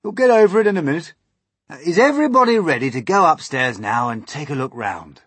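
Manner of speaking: high, loud rallying voice; brisk start, loud call to the group; old grasshopper. A narrator's reading. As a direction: brush off the worry and rally everyone.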